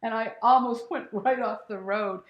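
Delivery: usual speaking voice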